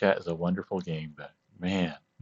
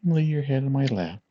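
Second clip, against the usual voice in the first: higher, gentle coaxing voice; tender coaxing; 6 semitones higher than usual. Acted. Coaxing friend onto lap.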